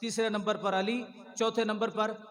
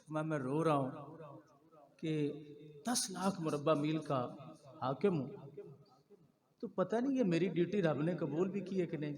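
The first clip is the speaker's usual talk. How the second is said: retold tearful humility; 6 dB quieter than usual. Preacher voices Umar weeping over his duty, understated tearful humility. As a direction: quietly voice a humbled man's worry, lightly acted. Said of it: "حضرت عمر نے رونا شروع کر دیا".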